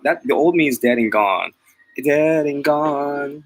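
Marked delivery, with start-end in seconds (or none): 2.0-3.4 slow, drawn-out, higher repeat; dramatic repeat. Real, not acted. Vlogger slowly declaring his old self dead. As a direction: stretch each word for drama.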